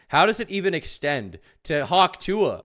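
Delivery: loud, high, baffled question; incredulous outburst. Real, not acted. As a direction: demand an answer in disbelief. Incredulous question about conspiracy believers.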